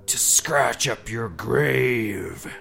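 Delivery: creature answering. Acted. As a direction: drag it out like a lurking beast.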